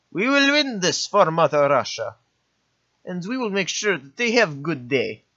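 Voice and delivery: mock Soviet general in a Russian accent. Rallying his Soviet troops for Mother Russia in a Russian accent. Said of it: "Some Russian accent there".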